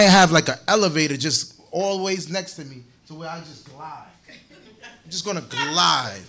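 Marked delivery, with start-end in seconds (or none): 4.3-6.2 laughing. Real, not acted. Jokes about gliding when rich.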